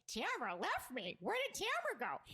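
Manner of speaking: put-on high whiny drunk complaint. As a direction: whine it like a tipsy friend. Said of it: "she'd be like".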